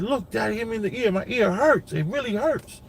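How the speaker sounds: put-on high, mildly whiny kid voice; imitating his hurt son, a touch of whine; son's voice. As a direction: play a boy complaining his ear hurts. Father voicing hurt son complaining.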